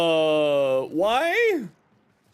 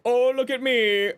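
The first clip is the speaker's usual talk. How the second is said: higher mock show-off voice; 7 semitones higher than usual. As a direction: parody a bragging player. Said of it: "Everyone's gonna be like".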